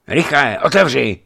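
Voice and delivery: loud, high, urgent call at the gate; urging shout. Acted. Rider urges old watchman to open, loud urgent shout. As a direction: hammer on the gate and shout for him to hurry. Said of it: "pobízel přicházejícího starého hlídače".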